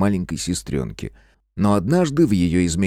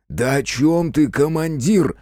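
higher, drawn-out friendly denial; breezy, stretched reassurance; jovial bravado; 7 semitones higher, slower than usual. A narrator's reading. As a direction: play innocent to the guard. Геныч placates the guard with bravado.

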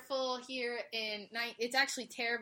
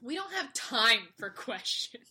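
mock-dramatic; 3 dB louder than usual. Mock-dramatic show intro line.

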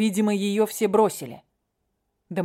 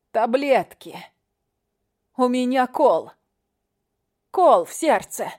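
desperate pain; 5 semitones higher, 4 dB louder than usual. Acted. Leila pleads, heart pain.

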